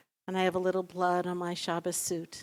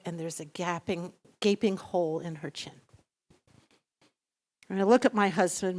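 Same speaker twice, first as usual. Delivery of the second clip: normal talking voice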